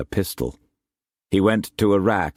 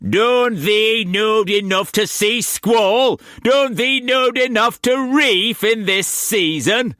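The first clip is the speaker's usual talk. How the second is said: furious shout; 5 dB louder than usual. A narrator's reading. Shaking fist in mate's face.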